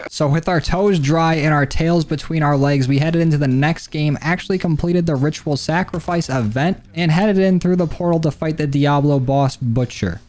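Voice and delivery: recap narration. A narrator's reading. Recap narration over gameplay.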